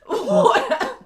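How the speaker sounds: high, loud laughing reply. Acted. Fosca laughs off her coughing fit, high and loud.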